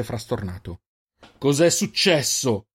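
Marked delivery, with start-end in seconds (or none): 1.4-2.7 slowed, louder furious question; furious demand. Acted. Jofix furiously demands answers from Roder, slow angry shout. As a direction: bark it out in rage. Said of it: "urlò a Roder, livido di rabbia".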